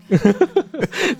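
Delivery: a burst of high-pitched giggling; high giggle. Real, not acted. Laughing at the joke she just made, high giggle. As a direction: giggle high at the silly line.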